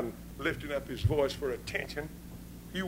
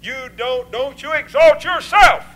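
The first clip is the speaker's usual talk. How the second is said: full-force preaching; 7 semitones higher, 13 dB louder than usual. Acted. Preacher relaying God's words to the congregation, full-force preaching.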